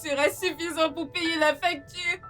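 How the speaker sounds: high, tearful wail; crying. Acted. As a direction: sob out the plea, words dragged by tears. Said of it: "Pourquoi tu pleures?". (en pleurs, voix aiguë)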